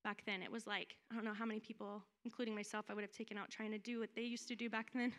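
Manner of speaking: an offhand remark, softly; quiet aside. Real, not acted. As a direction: drop the voice, as if to yourself. Aside, dropped voice.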